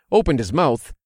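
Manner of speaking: ordinary delivery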